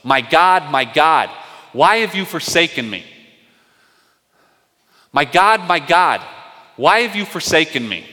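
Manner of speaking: a preacher voicing Jesus crying out loud on the cross. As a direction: cry it out to heaven in anguish. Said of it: "he cries out and he goes".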